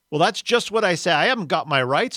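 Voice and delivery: aggrieved character outburst; indignant protest. Acted. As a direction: complain loudly that you have been cheated. Ghost protests about his rights.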